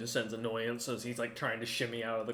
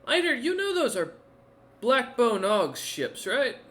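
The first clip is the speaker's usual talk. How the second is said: high, loud, alarmed check; pitched-up voice of sudden alarm; 11 semitones higher, 6 dB louder than usual. Acted. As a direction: ask urgently, pitch shooting up. Bard stops in alarm at ships.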